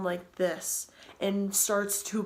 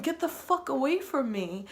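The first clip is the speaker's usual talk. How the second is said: higher-pitched acted outburst; retold angry rebuff; 6 semitones higher than usual. Girl telling the truck driver off, acted angry rebuff. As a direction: act out warding someone off, pitch raised.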